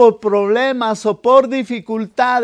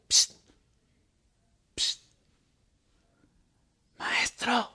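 hushed whisper; 10 dB quieter, slower than usual. Retold or imitated. Disciple sneaking up to wake Jesus.

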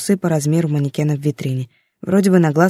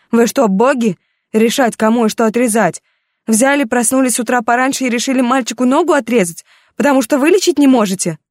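furious outburst; 7 semitones higher than usual. Acted. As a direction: shout at the doctor in outrage.

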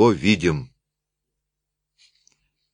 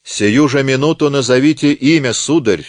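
louder, higher demanding judge; 6 semitones higher, 5 dB louder than usual. Acted. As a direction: insist on the name now. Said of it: "потребовал председательствующий". (требовательно, громче)

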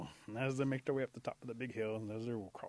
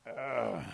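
long groan of discomfort; sore groan; 5 dB louder than usual. Acted. Possum with medicine still working, groaning. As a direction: let out an aching groan.

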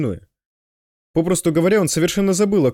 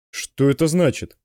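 ordinary delivery, nothing marked

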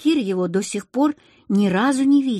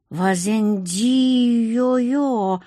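slow, drawn-out cry of wonder; awed gasp; 2 semitones higher, much slower than usual. A narrator's reading. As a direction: stretch the alien word out in awe. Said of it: "ахнули все пятеро".

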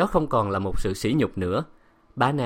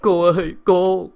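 high, loud wail to her mistress; drawn-out distraught plea; sobbing call; 8 semitones higher, 8 dB louder than usual. A narrator's reading. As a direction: cry out for help tearfully. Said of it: "bà lại khóc ngất".

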